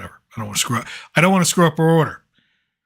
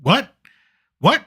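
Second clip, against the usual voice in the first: mildly incredulous, higher rising question; retold disbelief; 8 semitones higher than usual. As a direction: re-enact being taken aback by the offer. Retold disbelief at free-food offer.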